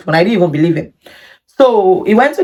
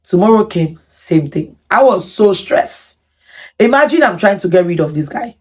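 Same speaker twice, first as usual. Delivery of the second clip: usual speaking voice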